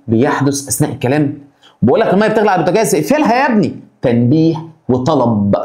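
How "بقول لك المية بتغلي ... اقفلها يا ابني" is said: fast, high mock nagging mother; urgent parent. Mother nagging son to turn off stove, fast and high.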